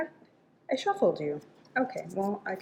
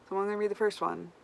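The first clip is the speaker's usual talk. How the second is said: ordinary talk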